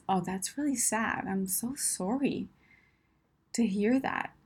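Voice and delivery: soft, slow sympathy; sadness. Shown as a demonstration. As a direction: offer quiet, sorrowful condolence. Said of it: "Or sadness".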